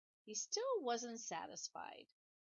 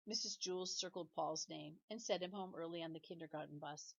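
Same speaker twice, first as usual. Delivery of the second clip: ordinary delivery, nothing marked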